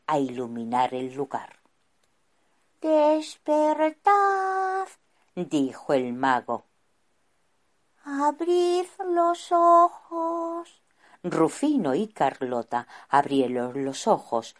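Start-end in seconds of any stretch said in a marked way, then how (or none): 2.8-10.8 slow wizard voice. Acted. Wizard wakes them slowly.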